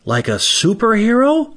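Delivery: put-on wondering four-year-old, voice climbing to the end; little daughter. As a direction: pitch it up like a small girl asking. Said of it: "And Ava says".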